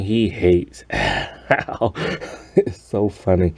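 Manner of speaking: real laugh breaking up the words; slow it down and crack up mid-sentence; genuinely tickled, laughing while talking. Real, not acted. Amused by the story, laughing.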